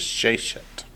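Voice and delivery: Sheshet accented on "She", softly and slowly; soft, front-stressed Sheshet; stress on first syllable. Shown as a demonstration. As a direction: lean on the opening syllable of Sheshet. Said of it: "except the masculine word pair form".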